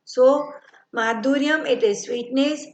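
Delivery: ordinary talk, nothing marked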